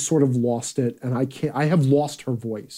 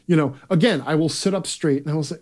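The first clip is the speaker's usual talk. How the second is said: normal talking voice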